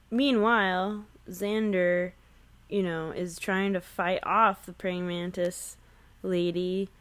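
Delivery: ordinary talk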